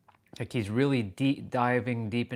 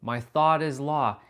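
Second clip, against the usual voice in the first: higher-pitched, swaying mantra recital; retelling an earlier attempt at a proud mantra; 5 semitones higher, 4 dB louder than usual. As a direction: declaim your mantra, stretching the key words. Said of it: "When I was saying".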